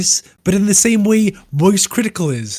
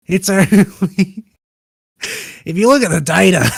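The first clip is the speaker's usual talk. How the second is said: laughing; 3 semitones higher, 4 dB louder than usual. Real, not acted. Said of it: "are you losing it".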